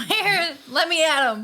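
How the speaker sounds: loud, high mock demand; aggressive mom. Aggressive friend storming the hospital, playfully loud and pushy. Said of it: "She's, like, an aggressive mom".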